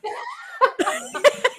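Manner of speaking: high bright burst of real laughter. Real, not acted. Sister laughing at being left out of the ad read.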